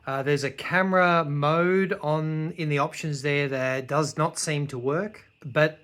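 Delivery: soft, low review narration; calm summing-up voiceover. Acted. Quiet recorded wrap-up voiceover.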